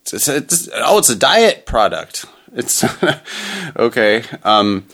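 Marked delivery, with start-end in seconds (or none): 0.0-2.3 bright, hammed-up ad pitch; chipper 1950s ad. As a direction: sell it like an old TV ad.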